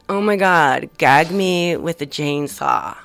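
hammed-up Valley girl. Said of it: "I talked like a Valley girl".

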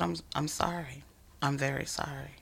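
soft whispered apology, trailing quiet; hushed apology. Retold or imitated. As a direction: murmur the apology gently. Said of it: "He just said".

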